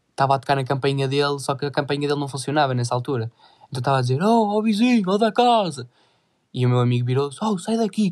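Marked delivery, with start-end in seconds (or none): 4.2-5.9 calling out. Retold or imitated. Man calling at the door. 7.4-8.1 high, sharp brush-off; chasing away. Retold or imitated. Friend chases man away.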